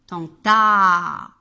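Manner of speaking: "Tenta" with a loud, long final a; vowel sounding-out. Shown as a demonstration. Teacher letting children hear the A, "Tenta" ending loud and long. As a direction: stretch the last a of "Tenta" loudly. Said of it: "ensuite j'entends A".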